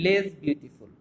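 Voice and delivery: slow, careful teacher read; slow model phrase. Shown as a demonstration. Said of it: "আমি বলবো".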